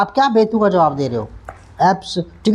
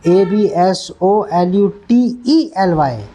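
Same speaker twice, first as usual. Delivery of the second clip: slow spelling; much slower than usual. Shown as a demonstration. Teacher spelling a word slowly to students.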